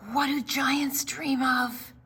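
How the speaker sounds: hushed whisper. Acted. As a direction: whisper the question so the giant won't hear. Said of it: "looks back and whispers".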